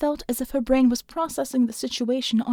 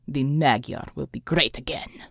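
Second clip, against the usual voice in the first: low villain boast; 8 semitones lower, 3 dB quieter than usual. A narrator's reading.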